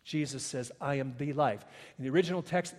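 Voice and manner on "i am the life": "the" said as a full "thee" before life; emphatic THE. Shown as a demonstration. Preacher showing the congregation the original text's emphasis, emphatic "the". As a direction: stress "the" in a low, measured voice. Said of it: "it is emphatic".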